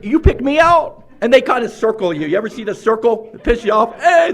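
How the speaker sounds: normal talking voice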